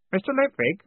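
put-on high, timid boy at the door; high-pitched nervous query. A narrator's reading. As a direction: ask it anxiously, unsure of interrupting. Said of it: "said a nervous voice from the doorway".

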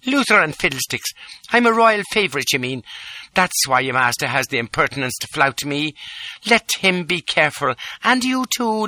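high, imperious tirade; royal rage. A narrator's reading. Lola raging at the little man, imperious. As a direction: scold him like a furious countess.

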